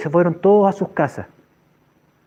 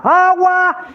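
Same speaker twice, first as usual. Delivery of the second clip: high, drawn-out cry; shouting; 12 semitones higher than usual. Retold or imitated. Man commanding the rain to come, shouted. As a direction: call the rain down at full voice. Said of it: "y gritaba".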